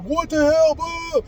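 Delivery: hammed-up group freak-out; mock outraged yell. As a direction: friends yelling at him in shock after the tires. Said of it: "everybody's like".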